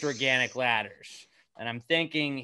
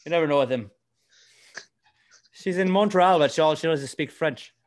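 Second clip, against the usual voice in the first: put-on Canadian accent drifting toward Irish, louder; 5 semitones higher, 7 dB louder than usual. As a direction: push a loud Canadian accent that slips Irish. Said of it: "I like that we're Irish".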